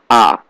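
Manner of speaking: A falling steeply; fourth tone. Shown as a demonstration. Teacher explaining the fourth tone, falling A. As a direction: let A drop from top to bottom. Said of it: "Fourth tone is going down".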